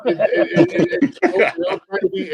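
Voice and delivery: high giggly stammer; breaking into high laughter; laughing. Real, not acted. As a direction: laugh through the words. Laughing while talking high.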